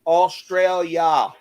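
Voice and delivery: mock Australian accent. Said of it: "thought he was from Australia".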